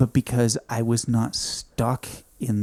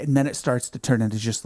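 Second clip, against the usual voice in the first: ordinary delivery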